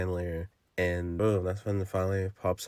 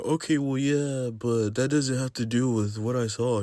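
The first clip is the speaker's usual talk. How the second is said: higher, defensive put-on girl voice; mock girlfriend voice; 5 semitones higher than usual. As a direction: defend yourself like the girlfriend, a bit whiny.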